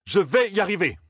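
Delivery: high, determined cry; shouted. A narrator's reading. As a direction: cry out that you can do it. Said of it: "cria Matt". (en criant)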